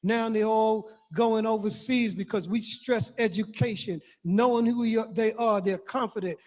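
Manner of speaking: ordinary delivery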